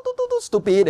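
high do-do-do bleep; mock censor beep. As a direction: bleep it out high before stupid. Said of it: "bleeping out words".

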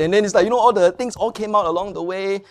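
normal talking voice

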